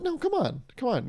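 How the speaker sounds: imitating a friend protesting, high; high-pitched protest. Acts out Elon's protest.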